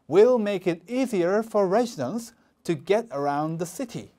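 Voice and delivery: slow, careful read-aloud, every word clear; slow model reading. Shown as a demonstration. Teacher modeling a sentence for students, slow and careful. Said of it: "이렇게 읽어주면 되겠죠".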